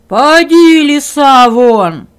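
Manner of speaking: very loud roaring order; bear's shout. Acted. Bear yells the fox out of the hut, very loud. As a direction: roar the fox out.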